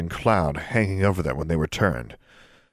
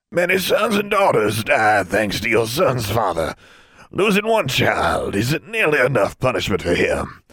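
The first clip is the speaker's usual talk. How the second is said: put-on hateful old wizard; 4 semitones higher than usual. Old wizard condemning Miss King's family, high and cold.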